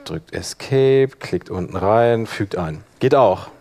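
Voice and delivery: ordinary talk, nothing marked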